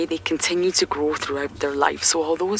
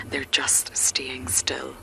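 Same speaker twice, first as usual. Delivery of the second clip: whispering; 4 dB quieter, much slower than usual. Real, not acted. Whispering near the elephants.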